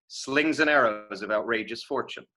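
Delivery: SLINGS AND ARROWS run together as one phrase. Shown as a demonstration. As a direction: join SLINGS AND ARROWS without any pauses. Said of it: "slings and arrows is a phrase".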